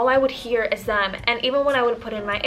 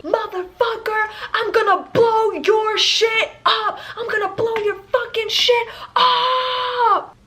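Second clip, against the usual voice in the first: imitated angry yelling; 4 semitones higher than usual. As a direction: yell the threats up through the ceiling, hammed up. Neighbor yelling threats upstairs.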